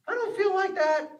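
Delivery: high-pitched doubting voice; put-on high objection; acted protest.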